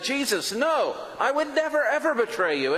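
high, pleading insistence; eager protest. Retold or imitated. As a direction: swear loyalty in a rush of high pitch. Protesting loyalty to Jesus.

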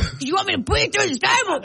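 high, hammed-up wrestler threat; playful fighting shout; mock threat.

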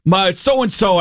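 high-pitched, eager retold phone invitation; excited buddy. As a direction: act out a buddy urging a friend out.

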